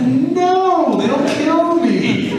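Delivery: high, slow laughing panic; mock terror, half laughing; panicked laugh. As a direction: squeal it out in mock dread. Panicked reply to himself.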